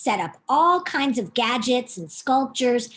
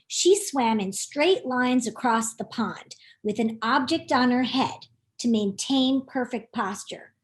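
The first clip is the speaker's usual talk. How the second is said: usual speaking voice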